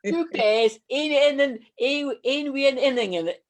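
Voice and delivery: broad Dundee Scots asked for by the host; broad Dundee accent. Shown as a demonstration. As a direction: say it like a true Dundonian. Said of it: "That's the famous Dundee".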